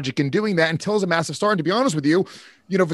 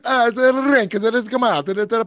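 higher mock Italian accent; 8 semitones higher than usual. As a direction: copy the Italian voice. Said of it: "I just did".

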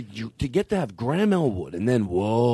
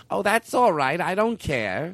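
impression of Libby; 6 semitones higher, slower than usual. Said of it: "my impersonation of her".

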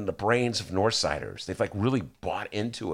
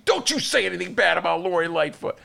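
higher, scolding warning; mock indignant Northsiders; 7 semitones higher than usual. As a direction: imitate outraged defenders, pitched up. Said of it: "north siders will walk up to you".

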